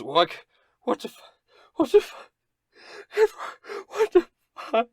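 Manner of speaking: very high, loud panicked shrieks; screaming in horror at the lost eye; hysterical panic. Acted. Halfling realizing his eye is gone, high hysterical shrieks. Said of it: "he begins to sob".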